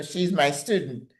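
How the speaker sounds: ordinary talk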